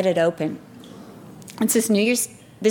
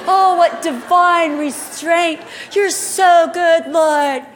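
high, loud, slow swooning praise; hammed-up worshipful rapture, slow and high; rapturous mock prayer; 8 semitones higher, 6 dB louder, slower than usual. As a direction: gush to the Lord in exaggerated bliss. Mocks own rapturous prayer before interruption.

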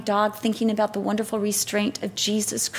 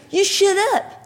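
high-pitched mock snap; sharp, higher retold put-down; snapped retort; 10 semitones higher than usual. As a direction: imitate a rude stranger snapping back. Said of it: "like that".

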